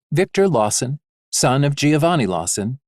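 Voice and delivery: plain reading voice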